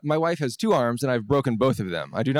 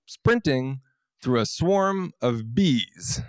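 usual speaking voice

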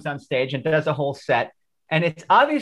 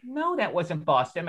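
calm reply; 7 dB quieter than usual. Retold or imitated. Usher calmly answers frantic kid.

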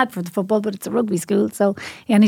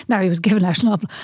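ordinary talk

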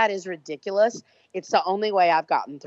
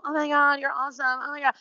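higher, gushing fan praise; mock hype; 6 semitones higher, faster than usual. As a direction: gush like an adoring fan. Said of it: "other people to go".

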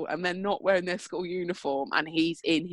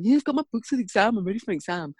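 higher, quick put-on teenage son voice; mock teenager, slightly hammed-up; 4 semitones higher, faster than usual. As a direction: brush off mum's question like a fed-up teen. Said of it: "he doesn't talk with that voice".

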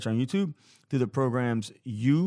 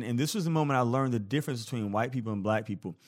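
ordinary talk

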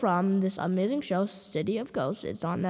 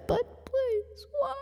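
mock whine; 4 dB quieter, slower than usual. Complaint about costume.

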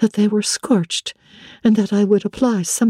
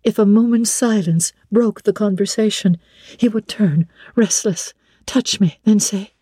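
plain reading voice

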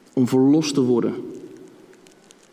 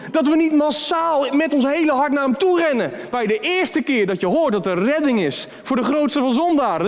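higher, urgent preaching, building; impassioned preaching; 11 semitones higher than usual. Acted. Preacher urging young people to come to Jesus, higher and urgent. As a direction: plead with the congregation, voice lifting high.

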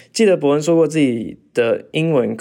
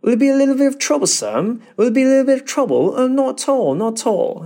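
high, hammed-up polite English voice; mock well-mannered Brit; overly polite British accent; posh British accent; 10 semitones higher than usual. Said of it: "他们一定会".

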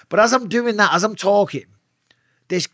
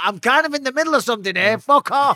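irritated brush-off; 10 semitones higher than usual. Retold or imitated. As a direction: snap at the caller to go away. Annoyed at wife calling back.

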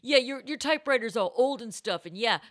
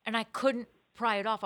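ordinary talk, nothing marked

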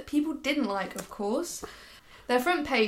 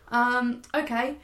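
normal talking voice

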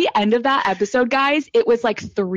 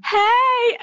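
very high, drawn-out 'hey'; retold greeting; 14 semitones higher than usual. Host re-enacting her hello back, squeaky-high greeting.

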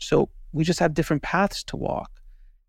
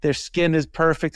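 ordinary talk, nothing marked

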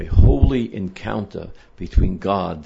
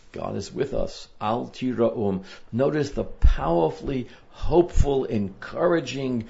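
ordinary talk, nothing marked

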